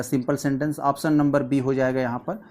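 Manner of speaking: usual speaking voice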